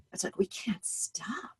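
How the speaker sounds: breathy drop to a whisper; hushed whisper. Acted. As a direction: whisper it after the frantic rush. Confiding the compulsion after frantic enactment.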